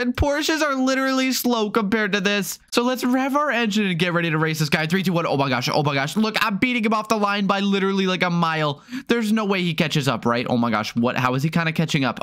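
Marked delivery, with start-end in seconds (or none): none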